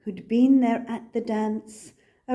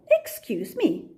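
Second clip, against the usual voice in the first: cricket clearing throat; 7 semitones higher than usual. Acted. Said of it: "coughed a cricket".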